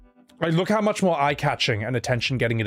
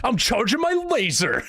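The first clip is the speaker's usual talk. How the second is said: mock movie gamer; 8 semitones higher than usual. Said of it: "how movies portray gamers".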